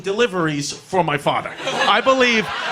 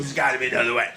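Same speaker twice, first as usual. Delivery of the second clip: gruff dad voice; 9 semitones lower, 4 dB quieter, much faster than usual. Retold or imitated. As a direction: lower and quieter, a murmured offer. Dad repeats the plea.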